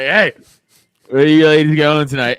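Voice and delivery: loud mock pickup call; imitated boozy shout across to strangers; drunken come-on. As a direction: holler it like a hammered guy hitting on women. Drunk cousin accosting two women.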